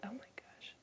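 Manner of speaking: breathless whispered sigh of a phrase; exasperated whisper. Real, not acted. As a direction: whisper it to yourself, fed up. Exasperated aside to self.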